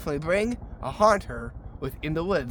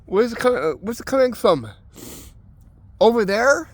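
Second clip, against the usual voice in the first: mock-startled, pitched up, asking where the sound came from; acted spooked; 4 semitones higher than usual. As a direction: play it spooked but a little hammy. Staged scare in the woods.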